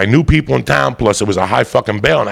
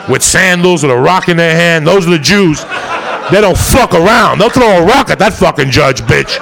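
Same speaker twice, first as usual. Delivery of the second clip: laughing rant; 6 semitones higher, 4 dB louder than usual. Real, not acted. Comic rant about tough lawyers.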